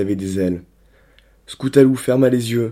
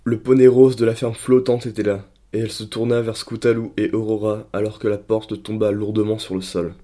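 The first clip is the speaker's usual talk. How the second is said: ordinary delivery, nothing marked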